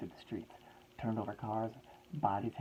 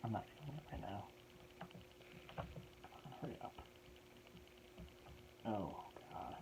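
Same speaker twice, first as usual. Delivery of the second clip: muttering to self; 12 dB quieter than usual. Real, not acted.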